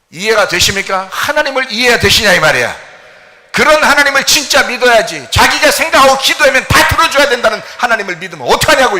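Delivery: loud, high challenging sermon; fervent preaching. Acted. Pastor challenging the congregation, loud fervent preaching. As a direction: demand an answer from the pews.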